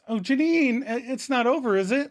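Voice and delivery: high, hopeful excited question; retold excitement. Ross asking Janine if it's over, excitedly. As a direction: ask it eagerly, pitched well up. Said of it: "Ross excitedly asks".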